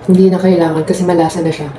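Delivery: speaking with food in mouth; mid-bite chatter; talking while chewing. Real, not acted. Seen 0: bit chicken at -1.0, lips close between words, still chewing.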